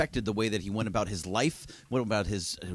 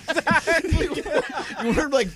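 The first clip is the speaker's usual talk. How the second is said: laughing. Real, not acted. Laughing at joke.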